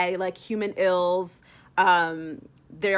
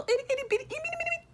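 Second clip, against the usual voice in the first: high itty bitty; 5 dB quieter, much faster than usual. Real, not acted. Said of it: "mocking tiny request".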